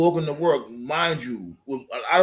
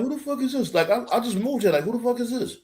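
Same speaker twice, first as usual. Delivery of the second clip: baffled self-quote; 8 semitones higher, faster than usual. Retold or imitated. New resident asking who knocked on his door, baffled and high. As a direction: act out being thrown by an unexpected knock.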